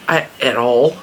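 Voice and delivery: normal talking voice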